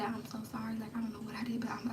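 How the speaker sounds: breathless whisper, barely voiced; whispered prayer. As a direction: whisper it so nobody hears you. Hiding, praying for rescue.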